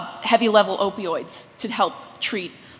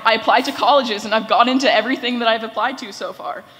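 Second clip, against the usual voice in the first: normal talking voice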